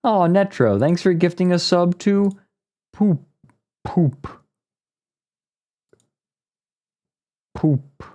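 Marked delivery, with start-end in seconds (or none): 2.9-8.0 lightly mock "Poop" pushed out through puffed cheeks; a light imitation of the pastor's cheek-puffed "Poop"; imitating puffed-cheek poop. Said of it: "He says it with his cheeks".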